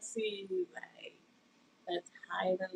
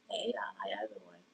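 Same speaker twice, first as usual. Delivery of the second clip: soft whispered hello, softer; whispered greeting; 6 dB quieter than usual. Real, not acted. Greeting viewers on her live stream, whispered.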